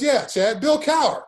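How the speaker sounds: retold coach's voice, high and punchy; Bill Cowher. Coach correcting a confused player, emphatic. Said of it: "He goes".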